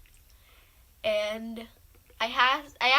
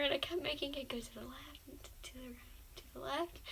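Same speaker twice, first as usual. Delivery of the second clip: a hushed whisper; 14 dB quieter than usual. Real, not acted. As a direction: whisper so the teacher won't hear. Quietly rocking chair in lesson.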